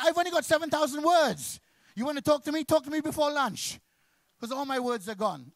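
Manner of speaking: loud high frantic protest; exasperated shout. Acted. Husband yelling to his wife that his words are used up, exasperated shout.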